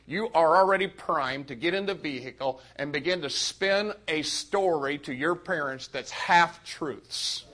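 usual speaking voice

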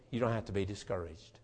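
usual speaking voice

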